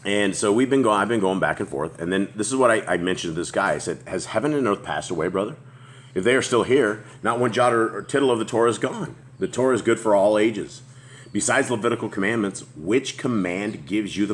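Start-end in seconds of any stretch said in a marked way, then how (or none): none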